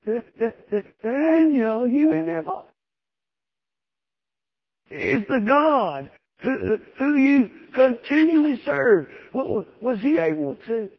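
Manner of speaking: stammering, high, slow lament calling Daniel; lamentable voice. Retold or imitated. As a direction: call into the den in anguish, stuttering. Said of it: "in a lamentable voice".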